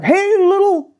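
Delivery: a hammed-up biker greeting; bikers calling out. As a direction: call it out cheerfully across the street. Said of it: "they all like".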